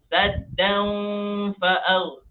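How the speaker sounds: high chanted SADDAN, n hummed halfway toward FA; neither a clear n nor merged, SADDAN glides into FA'AR; veiled n before fa. Shown as a demonstration. Teacher demonstrates the halfway reading, blurred nasal n. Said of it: "di tengah-tengah".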